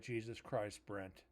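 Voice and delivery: low, hushed mock exasperation; soft, sinking reproach, trailing away; imitated weary disapproval. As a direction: mutter it low, as a fed-up coworker. Voicing coworkers' weary reaction.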